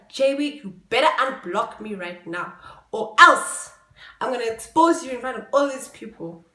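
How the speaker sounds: loud furious ultimatum; angry threat. Retold or imitated. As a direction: snap out the threat to unblock. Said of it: "I tell him".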